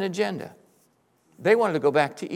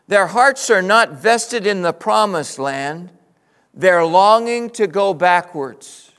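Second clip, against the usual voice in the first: usual speaking voice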